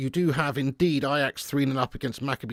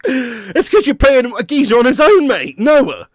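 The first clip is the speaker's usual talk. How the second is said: loud, high laughing through the words; laughing mockery; 8 semitones higher, 10 dB louder, slower than usual. Real, not acted. Fan mocking Chelsea's weak opponent, laughing loudly. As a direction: cackle while mocking the opponent. Laughing at six nil result.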